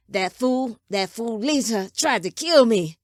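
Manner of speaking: loud, heated outburst; angry accusation. A narrator's reading. Father telling son of attempt on his life, angry and loud. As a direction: raise the voice in outrage.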